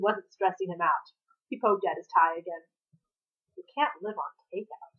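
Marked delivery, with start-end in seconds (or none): 3.6-4.9 quiet sulky mumble; muttered. A narrator's reading. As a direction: mutter it under your breath. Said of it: "Tim muttered".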